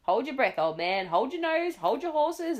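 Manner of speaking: monkey hyping up the show; excited monkey. A narrator's reading. Monkey excitedly tells Duke.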